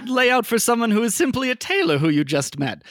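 high, amused shopkeeper voice; Tim Taylor in character. Acted. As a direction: remark it bemused, high. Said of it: "he says that in character".

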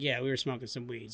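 low, soft, matter-of-fact confession; honest admission. Retold or imitated. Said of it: "So I'm totally honest".

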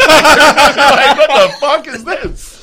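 loud, high, through hard laughter; cracking up while acting baffled outrage; laughing disbelief. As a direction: squeal the question through laughter. Cracks up at casting joke.

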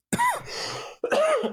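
real coughing fit after inhaling dust. Real, not acted. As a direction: cough hard, caught by dust. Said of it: "I just inhaled some dust".

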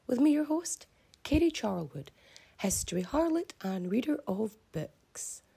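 bright, upbeat show opening; podcast intro. Acted. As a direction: announce the show with energy.

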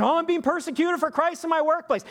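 very high mock whine; mock self-pity. Lazy worker claims persecution at the workplace, mock self-pity. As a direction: complain in a high, put-upon voice.

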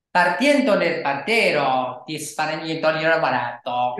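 slow, sing-song mock Italian with no real words; a singy-songy Italian cadence, lilting and slow; fake Italian gibberish. Shown as a demonstration. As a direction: sound Italian without speaking Italian. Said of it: "Like, listen to this".

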